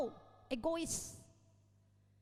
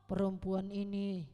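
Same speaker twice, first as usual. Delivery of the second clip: Adam blaming, low; 7 semitones lower, slower than usual. Retold or imitated. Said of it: "Tuhan bilang".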